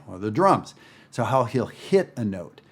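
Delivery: ordinary talk, nothing marked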